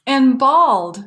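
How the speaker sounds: loud, high bawl. A narrator's reading. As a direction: let the calf cry out to her friend. Calf bawls.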